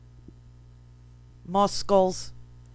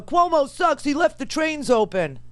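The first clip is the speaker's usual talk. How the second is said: whiny protest pitched up high; mock complainer; 6 semitones higher than usual. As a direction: imitate an indignant heckler. Mimics complainers she criticizes.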